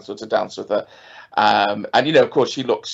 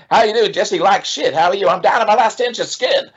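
raised, bantering voices; tossing off dark banter; quoted dialogue; 8 semitones higher than usual. Retold or imitated. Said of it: "one of the great bits of dialogue".

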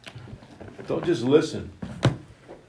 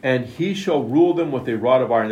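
scripture read with weight, the word rule leaned on; scripture read aloud; 2 semitones higher, 4 dB louder than usual. Acted. Preacher reading scripture aloud.

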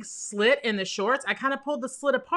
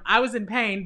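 ordinary talk

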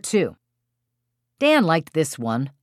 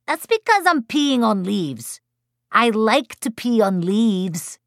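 put-on high-pitched two-year-old; very high small-child voice, stretching it out; recalcitrant toddler; 7 semitones higher than usual. A narrator's reading. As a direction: insist like a little kid. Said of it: "two and a half year old".

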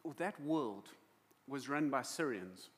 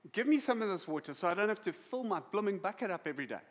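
quick, raised, cocky demand; sarcastic; 4 semitones higher, 5 dB louder, faster than usual. Acted. Samaritan woman cheekily asking Jesus for water, sarcastic. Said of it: "she is still being sarcastic".

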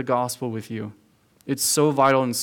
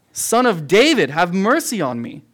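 blind man shouting louder for mercy; loud desperate cry; 4 semitones higher, 4 dB louder than usual. Retold or imitated. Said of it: "he gets louder".

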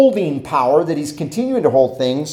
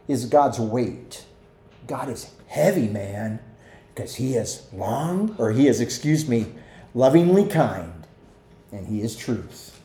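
lightly voiced laid-back hippie, lower and softer; hippie talk; 6 semitones lower, 6 dB quieter than usual. Preacher teasing the congregation with mild hippie slang, lower and softer. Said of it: "the hippie talk there".

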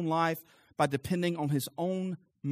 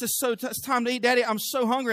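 put-on higher, lightly whined kid voice; mock whine, pitched up a little; whiny child; 7 semitones higher, 5 dB louder than usual. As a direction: imitate a little boy begging for dinner. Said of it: "comes in his whiny voice".

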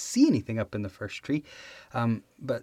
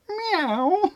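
high meow imitation; squeaky cat sound; 6 dB louder than usual. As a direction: mew like a cat to fool the cop. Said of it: "he goes".

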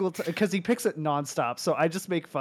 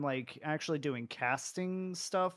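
ordinary talk, nothing marked

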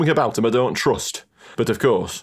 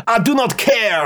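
higher, crying out with passion; cried out; 10 semitones higher than usual. Acted. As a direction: cry it out, heart on sleeve. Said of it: "Cried Christoph".

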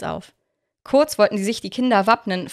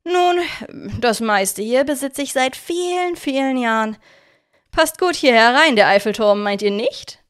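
smug villain; 3 semitones higher than usual. Acted. Victor boasting about his stolen attractions, smug. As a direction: show off your loot with pride.

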